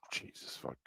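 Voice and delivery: breathy muttered swear under the breath; real whispered aside after a stumble; whispered curse. Real, not acted. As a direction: whisper it to yourself, annoyed.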